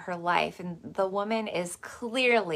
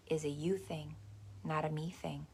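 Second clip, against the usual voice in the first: softer, quiet put-down; soft punchline; 2 semitones lower, 10 dB quieter than usual. Real, not acted. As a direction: drop it softly and knowingly. Catchphrase, softened delivery.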